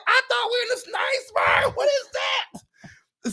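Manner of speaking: very high-pitched acted freak-out; panicked shriek. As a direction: shriek in alarm at rats, then stretch the question out. Retold panic at seeing rats.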